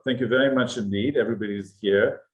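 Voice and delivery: normal talking voice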